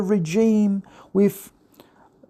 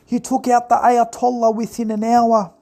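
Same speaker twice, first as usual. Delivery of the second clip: mock gushing fan; 4 semitones higher than usual. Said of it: "You're all praising Trump".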